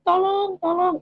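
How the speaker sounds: high, drawn-out imitated cries for help; retold plea for help. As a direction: call for help high, without raising volume. Woman in mud begs for help.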